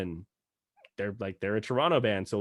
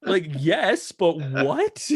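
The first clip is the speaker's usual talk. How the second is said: higher, louder puzzled reaction; baffled disbelief; 5 semitones higher, 5 dB louder, much slower than usual. Retold or imitated. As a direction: act out happy bewilderment, pitched up. Continues retold baffled reaction.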